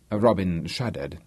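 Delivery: usual speaking voice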